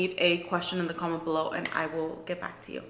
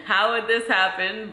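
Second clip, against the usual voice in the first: laughing; 6 semitones higher, 5 dB louder, much slower than usual. Real, not acted. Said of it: "It's crazy".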